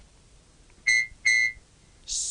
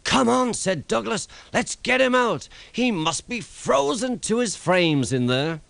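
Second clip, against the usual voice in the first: urgent call; 11 semitones higher than usual. A narrator's reading. Douglas urging the men to free Thomas, urgent higher call. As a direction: hurry them to dig him out.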